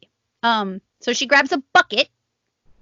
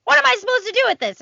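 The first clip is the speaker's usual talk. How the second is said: quick, higher, helpless question; mock baffled mom; 10 semitones higher, faster than usual. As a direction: fire it off, at a loss. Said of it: "she's like".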